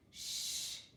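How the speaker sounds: voiceless shushing sound; hushing. A narrator's reading. Fox hushes noisy friends.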